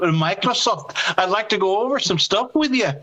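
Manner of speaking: mock Scottish brogue; Jackie Stewart. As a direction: play a chatty caller with a Scottish accent. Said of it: "a little bit more broguish".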